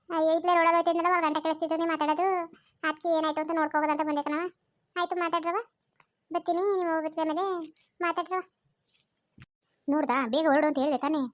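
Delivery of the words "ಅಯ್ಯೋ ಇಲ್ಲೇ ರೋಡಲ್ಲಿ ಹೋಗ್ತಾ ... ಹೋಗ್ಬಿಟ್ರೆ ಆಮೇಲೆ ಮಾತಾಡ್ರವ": put-on higher-pitched curious older auntie calling out; a busybody aunty barging in to ask what the fuss is; prying neighbour aunt voice, higher pitched; nosy village aunt. Same aunt figure returns.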